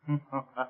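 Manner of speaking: low, soft villainous chuckle; soft gloating laugh; sinister chuckle. Acted. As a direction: chuckle quietly over a wicked plan. Villain relishing his planned surprise.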